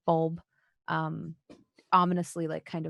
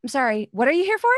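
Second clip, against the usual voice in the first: quick, loud, higher, curt question; brisk torturer woman; 7 semitones higher, 6 dB louder, faster than usual. Acted. Torturer woman snapping at the intruding party, loud and quick. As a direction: snap at the intruders, annoyed. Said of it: "she's like".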